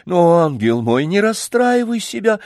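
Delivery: high, fluttering coaxing plea; babbling lady. A narrator's reading. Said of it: "залепетала над ним дама".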